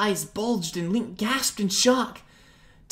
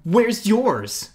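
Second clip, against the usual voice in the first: louder quick retort; shot back; 4 semitones higher, 8 dB louder than usual. Acted. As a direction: fire it straight back. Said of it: "Link shot back".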